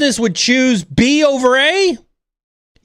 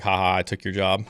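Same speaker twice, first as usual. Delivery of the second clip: mocking robot laugh; 13 semitones lower, 9 dB quieter, faster than usual. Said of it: "actually do the laugh and be like".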